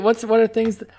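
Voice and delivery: usual speaking voice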